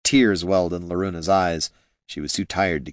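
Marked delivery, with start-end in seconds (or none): none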